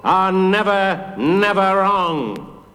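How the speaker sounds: high, very drawn-out chant; crowd calling back. A narrator's reading. Big people answering the judge in court, chanting slowly. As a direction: stretch each word like a chanting crowd. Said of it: "shouted back".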